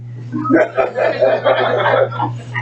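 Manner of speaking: breathy amused laugh; soft unvoiced chuckle. Real, not acted. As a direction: laugh at the washer's cheek. Laughing at the story.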